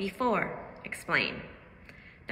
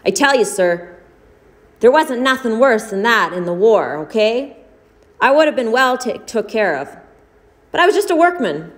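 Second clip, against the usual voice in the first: expressive read-aloud, louder than her chat; story narration; 11 dB louder than usual. Acted. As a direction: read the story to the class with drama. Teacher reads short story aloud.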